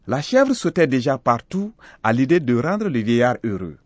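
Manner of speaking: plain reading voice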